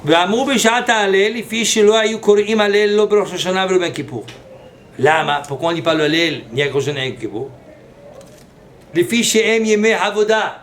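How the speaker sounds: loud, emphatic sermon reading; preaching. Acted. Rabbi preaching loudly to his listeners. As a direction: proclaim the text to the congregation. Reads and explains the Mahzor text.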